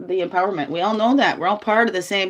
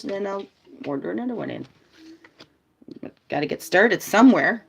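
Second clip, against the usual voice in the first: ordinary talk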